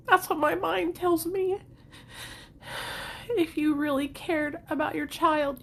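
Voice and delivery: talking through real tears; softly weeping while recalling her inner voice; crying. Real, not acted.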